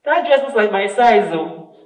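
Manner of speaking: contrasting tone. Shown as a demonstration. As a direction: say it out loud as a pointed remark. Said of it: "big difference in the way that we convey".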